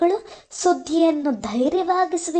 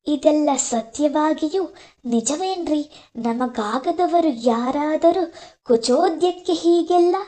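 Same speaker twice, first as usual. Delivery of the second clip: usual speaking voice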